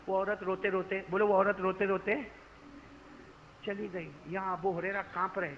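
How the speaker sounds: normal talking voice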